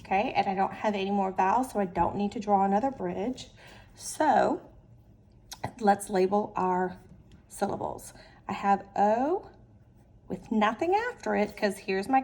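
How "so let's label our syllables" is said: ordinary talk, nothing marked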